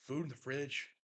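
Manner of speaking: words pushed past heartburn. Real, not acted. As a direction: talk through a burning chest. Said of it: "Excuse me, heartburn".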